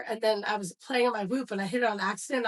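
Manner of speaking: usual speaking voice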